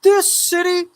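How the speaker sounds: high fed-up woman's voice; imitating an indignant friend. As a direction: mimic her cousin's wife, worked up. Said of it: "She goes".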